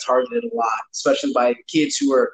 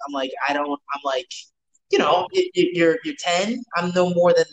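ordinary talk

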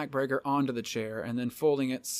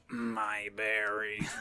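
very slow robotic monotone; 3 semitones lower, much slower than usual. Retold or imitated. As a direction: drag it out flat and mechanical. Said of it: "and go".

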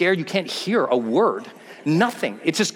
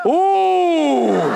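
scared ooh; 9 semitones higher, 4 dB louder than usual. Retold or imitated. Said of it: "I look at him like".

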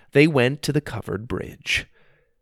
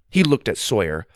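plain reading voice

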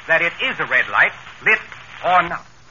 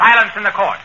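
higher and louder gavel call; judge's command; 5 semitones higher, 5 dB louder than usual. Acted. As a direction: call the court to order. Judge opening court proceedings.

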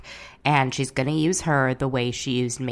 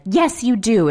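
imitated angry retort; 7 semitones higher, 5 dB louder than usual. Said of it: "Brandi's like".

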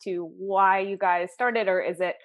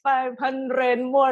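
put-on slow Scottish accent cracking into laughter; mock Scottish, laughing; much slower than usual. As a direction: barely hold the Scottish accent through a laugh. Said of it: "the Scottish accent".

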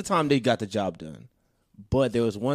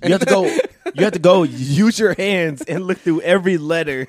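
mock disbelief; 5 semitones higher, 6 dB louder than usual. Mocking impossible search.

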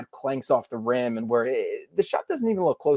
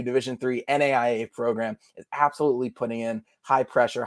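normal talking voice

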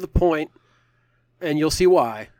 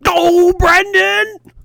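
a long, very high, loud, slow exasperated cry; exasperated wail; 13 dB louder, much slower than usual. Pretend. Co-host despairing at a joking question about Ewoks. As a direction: wail the name in mock despair.

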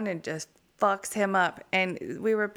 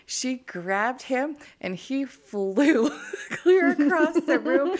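laughing through; 5 semitones higher, 5 dB louder, slower than usual. Real, not acted. Laughing while telling story.

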